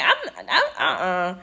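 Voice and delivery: giggling no way; laughing refusal. Retold or imitated. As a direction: shake it off with a laugh. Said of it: "I was like".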